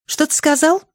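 put-on very high girl's voice, questioning; Нори. A narrator's reading. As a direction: ask back in a high, girlish pitch. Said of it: "переспросила Нори Бенни". (высокий девчачий голос, переспрос)

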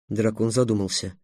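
ordinary delivery, nothing marked

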